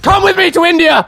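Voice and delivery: put-on loud high Indian accent. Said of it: "my Indian accent".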